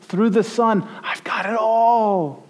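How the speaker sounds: put-on a fond father calling his child; tender heavenly father. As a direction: coax like a loving father. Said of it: "Your father says".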